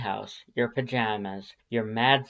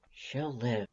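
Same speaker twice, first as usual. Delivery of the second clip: much softer, hushed; whispered; 7 dB quieter than usual. Acted. Undyne answering about the hurt girl, hushed and soft. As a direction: let it fall to a hush. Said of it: "she whispers".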